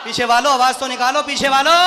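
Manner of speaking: loud rallying shout to the back rows; calling out. Real, not acted. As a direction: rouse the crowd at the back.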